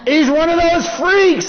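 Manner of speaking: high-pitched comic horror; shocked outburst. Acted. Man discovers his best friend is a Hare Krishna, shocked outburst. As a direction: blurt out the appalling discovery.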